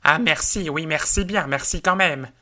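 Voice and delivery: a dazed dismissed housekeeper repeating her thanks; lady's hollow thanks. Acted. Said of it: "répétait".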